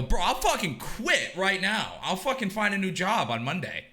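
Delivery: a laughing, over-the-top yes to the offer; excited disbelief. Retold or imitated. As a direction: act thrilled enough to quit on the spot. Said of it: "I go".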